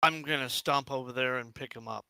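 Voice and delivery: normal talking voice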